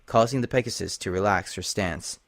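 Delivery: usual speaking voice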